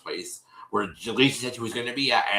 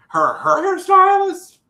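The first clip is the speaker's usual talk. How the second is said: a squealing high incredulous question; shocked disbelief; 11 dB louder, slower than usual. Real, not acted. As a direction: shriek it in disbelief. Incredulous shriek at the claim.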